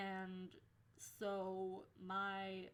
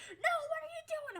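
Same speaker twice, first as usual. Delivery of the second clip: high, loud shriek of alarm; panicked friend; 10 dB louder, faster than usual. Retold or imitated. Friend pulling her off the boy, in a high panicked shriek. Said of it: "my friend grabs me and he's like".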